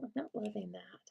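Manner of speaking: whispered under her breath; whispering. Real, not acted. Crafter muttering to herself while arranging dies, whispering. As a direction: murmur it to yourself.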